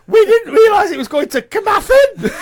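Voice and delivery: high squeaky voice through laughter; helium voice. Retold or imitated. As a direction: giggle through a silly high voice. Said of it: "something off Monty Python".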